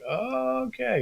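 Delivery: drawn-out, high, soft okay; dubious okay. Retold or imitated. Creator recalling being snubbed by peers, dubious. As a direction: stretch it out high, as if baffled.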